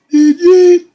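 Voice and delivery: very high, loud chant; crowd chant. Retold or imitated. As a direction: chant her name like the crowd.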